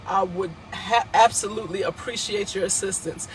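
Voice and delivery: normal talking voice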